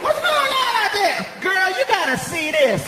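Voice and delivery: high call to a coworker. Retold or imitated. Clerk calling her coworker out back, high holler.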